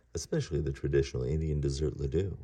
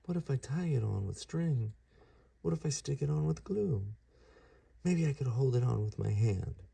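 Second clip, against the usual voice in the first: put-on higher, wondering young voice; pondering kid god; 9 semitones higher than usual. Ganesha trying ideas to fix his tusk, higher wondering voice. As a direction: think aloud, hopeful.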